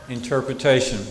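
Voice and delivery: ordinary talk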